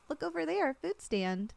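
ordinary talk, nothing marked

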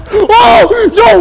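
high, loud burst of alarm; startled shout. Real, not acted. Player jump-scared by Freddy, startled high shout.

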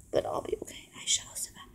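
unvoiced whisper, almost inaudible. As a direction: breathe the words without voice. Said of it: "that sounded terrible".

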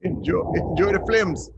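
put-on playful high Jamaican accent; hammed-up Jamaican lilt. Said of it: "we're terrible with the Jamaican accents".

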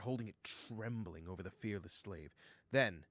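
plain reading voice

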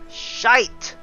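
normal talking voice

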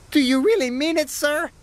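high, fast, delighted little engine; proud and excited. A narrator's reading. Percy asking Sir Topham Hatt, thrilled and proud. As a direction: puff it out proudly and quickly. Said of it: "puffed Percy proudly".

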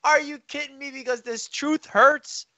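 high mock whine of the upset cohost, slowing at the end; mocking the rival. Mimicking his upset Texans-fan cohost, high mock whine. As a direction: imitate him sulking in disbelief. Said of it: "He's like".

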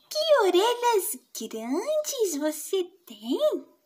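put-on slow, wondering child's voice; amazed little girl. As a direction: marvel slowly at the big ears. Girl marvels at grandma.

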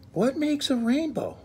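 high, curious little bunny voice; Little Rabbit asking. Acted. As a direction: ask like a tiny wondering rabbit. Little Rabbit asks his mother.

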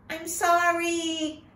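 put-on high, slow child's apology; boy Ko apologizing to the talking taro root, high and slow; slow sorry little boy. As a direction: stretch the sorry out like a startled boy. Ko voiced as a child.

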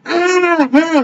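high-pitched drawn-out cat yowl; imitated cat yowl. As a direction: mimic a trodden-on cat's cry. Imitates cat that was stepped on.

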